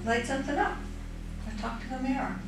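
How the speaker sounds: soft coaxing hush; nervous night vlog whisper. Real, not acted. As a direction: coax the spirit under breath. Calling spirit softly.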